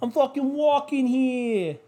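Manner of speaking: high, drawn-out fake New York accent; bad New York accent. Podcaster hamming up his bad New York accent. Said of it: "That's a bad New York accent".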